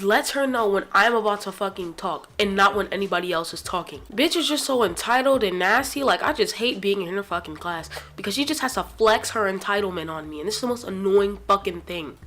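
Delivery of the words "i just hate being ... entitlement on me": usual speaking voice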